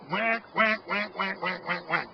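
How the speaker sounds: high loud quacking whacks; duck comeback call. Shown as a demonstration. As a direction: bark it out like a calling duck. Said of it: "It's usually an eight-note spread".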